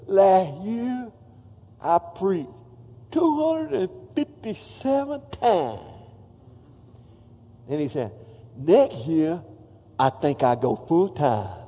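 imitating David Ring's speech. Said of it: "He talk like this".

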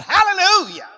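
high, loud hallelujah shout. Shown as a demonstration. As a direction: lead the church in a shout. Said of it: "Somebody shout".

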